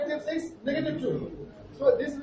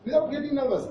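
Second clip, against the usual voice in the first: normal talking voice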